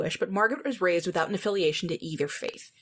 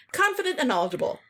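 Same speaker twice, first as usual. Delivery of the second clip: ironic, higher repeat; mock-earnest sarcasm; sarcastic echo; 7 semitones higher than usual. Real, not acted. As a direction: repeat it with a raised eyebrow. Mocking repeat of summary.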